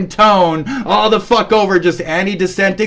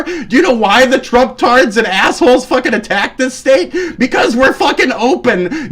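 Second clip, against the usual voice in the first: high, agitated rant; worked-up, raised-pitch tirade; angry outburst; 4 semitones higher than usual. Real, not acted. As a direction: hurl it back in real indignation. Heated rant against an accuser.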